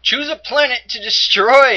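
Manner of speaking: high, loud theatrical read-out; hammed-up loud prompt reading; reading game prompt. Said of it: "it says".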